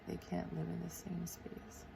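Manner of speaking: very soft whispered self-dictation; hushed, breath-only note to self; whispering while writing. Real, not acted. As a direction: whisper it to yourself as you note it. Murmurs the quote while writing it.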